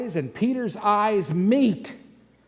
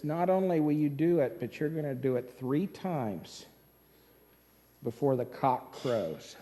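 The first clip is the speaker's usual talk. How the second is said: quiet solemn warning; 4 semitones lower, 6 dB quieter than usual. Acted. Jesus voiced in sermon.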